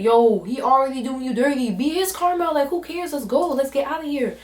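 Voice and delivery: high and loud, let's get outta here; exasperated push to ditch him; fired-up urging. Retold or imitated. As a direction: hype her up to leave him. Urging friend to leave him.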